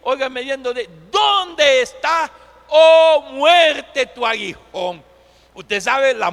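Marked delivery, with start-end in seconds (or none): 1.1-5.0 loud, slow, high challenge dropping at the end; booming defiant question. Acted. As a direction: ring out a challenge to death, then let it fall. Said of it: "Pablo le dice a la muerte desafiante".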